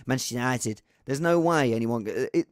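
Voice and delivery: ordinary delivery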